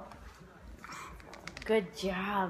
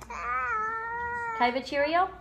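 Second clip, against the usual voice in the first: voicing the baby; 9 semitones higher, slower than usual. Mom voicing baby's request.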